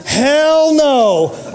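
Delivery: loud and high "Hell no"; loud, high rejection, half laughing; emphatic. Real, not acted. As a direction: slam the idea shut. Emphatic rejection of pious reply.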